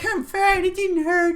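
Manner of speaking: high, squeaky kid playing an old man; a small boy faking a gruff old man; little kid old man voice. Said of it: "Like a little kid old man voice?".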